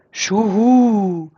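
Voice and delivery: calm rising owl hoot, Schuhu. A narrator's reading. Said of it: "fragte sie mit ruhiger Stimme".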